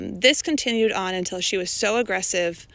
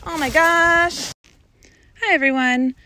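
excited gush; 9 semitones higher, 8 dB louder than usual. Real, not acted.

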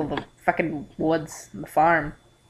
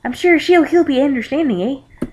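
higher mock Canadian accent; hammy Canadian voice; 7 semitones higher, 3 dB louder than usual. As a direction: try it as a Canadian with a lifted "eh". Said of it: "Maybe that's Canada?".

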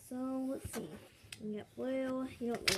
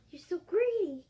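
loud, high mock scolding; hammed-up indignant server; scolding waitress; 8 semitones higher, 10 dB louder than usual. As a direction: snap loudly as a fed-up pretend waitress. Waitress quarrels over plates.

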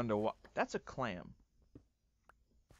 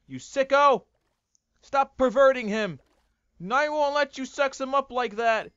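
higher, louder outraged shouts; angry yelling; 9 semitones higher, 8 dB louder than usual. Real, not acted. Streamer yelling at a creature attacking another. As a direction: scream at the attacker to stop.

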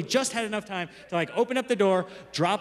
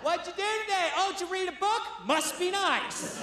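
put-on sarcastic wife, stretching the final dig; spiteful wife; 10 semitones higher, 3 dB quieter than usual. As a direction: needle him in a high, spiteful wife voice. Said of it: "just spite visit me".

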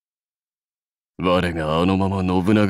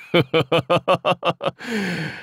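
high rolling warlord laugh; amused belly laugh; hearty laugh; 8 semitones higher than usual. Acted. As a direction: laugh in a long string, then let it fall.

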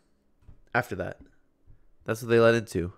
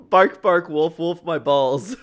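laughing dog impression; 3 semitones higher, 3 dB louder, much slower than usual. Friend echoes the dog line.

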